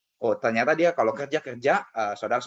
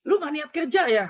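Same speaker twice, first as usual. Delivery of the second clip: high, loud outburst of scolding; angry scolding; 5 dB louder than usual. Retold or imitated. Uncle scolding his new worker on arrival, angry shout. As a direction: tear into the late-comer in a high, raised voice. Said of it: "begitu nyampe saya diomelin".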